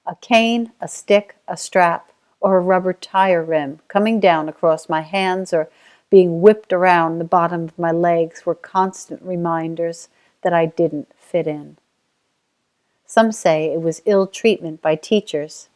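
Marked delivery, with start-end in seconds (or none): none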